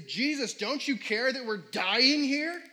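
loud, high, slow panicked cry to Jesus; panicked plea. Retold or imitated. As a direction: plead in terror as the boat sinks. Disciples panicking in retold story.

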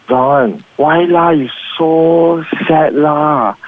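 slow Manglish with la on the line ends; lots of la. Shown as a demonstration. Manglish couple scene, la stretched slow. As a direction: drag out the la's, sweet and slow. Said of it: "add more la's".

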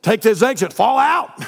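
high, loud barked orders; General Patton voice. Retold or imitated. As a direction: shout commands like a general. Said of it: "that's how he talks".

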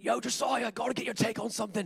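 high-pitched throaty Australian neighbor impression; Australian throat voice. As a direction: mimic an Aussie bloke talking from his throat. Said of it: "he had, like, a throat voice".

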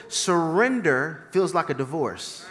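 ordinary talk

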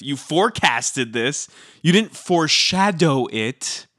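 slow, weighty stress on "forecasted" and "foreshadow"; pointed correction. Real, not acted. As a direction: spell out "forecasted" against "foreshadow", drawn out. Correcting a wrong word choice.

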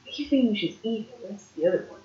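ordinary delivery

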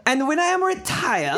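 put-on high, loud, laughing German accent; bad German accent. Rereading the cyclist's quote, laughing German accent. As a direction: ham the German through a laugh. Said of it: "That was a terrible accent".

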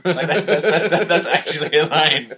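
cracking up at an absurd line; laughing. Real, not acted. Host laughing at the Harrison Ford line, laughing.

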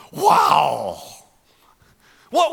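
normal talking voice

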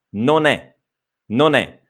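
"non è" run together as "nonè"; linked as one word. Shown as a demonstration. Teacher showing "non è" linked as one word. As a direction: join "non è" into a single word. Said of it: "Come se fosse un'unica parola".

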